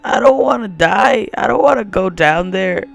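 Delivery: trembling whiny yelps. Pretend. Refusing to jump.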